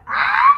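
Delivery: a sudden high squeal of disbelief; high shocked shriek. Real, not acted. Vlogger realising she turned twenty-five, high shriek. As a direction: shriek as it dawns on you.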